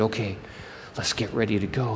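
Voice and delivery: low, soft robber in hiding; secretive near-whisper; hushed plotting. Acted. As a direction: keep it down so nobody hears. Robbers hiding behind the rock.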